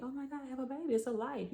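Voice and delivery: high-pitched, recalled thrill at normal volume; excited. Retold or imitated. Recalling the pregnancy news to viewers, high excited retelling. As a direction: lift it high, reliving the happy news. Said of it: "I was excited about that".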